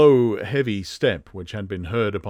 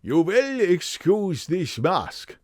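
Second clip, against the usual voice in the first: very slow, high German; strong German accent; 7 semitones higher, slower than usual. Acted. Strange visitor excusing his mask, slow German accent. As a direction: stretch each word, heavily German. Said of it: "a strongly marked German accent".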